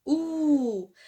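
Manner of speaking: "ou" as one long oo; drawn-out ou. Shown as a demonstration. Teacher repeating the sound of O avec U, long held ou. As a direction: hold "ou" and let it slide down. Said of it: "elle se prononce".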